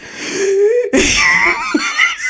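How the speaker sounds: high, drawn-out wheezy gasps; acted wheezing. Sweating passenger after the sprint, wheezing. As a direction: imitate struggling for breath, laughing through it. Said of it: "and I'm just".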